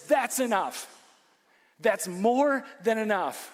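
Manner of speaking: firm, loud and slow, each word weighed; defiant declaration. Acted. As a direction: plant your feet and refuse the giant. David's defiant words, preached.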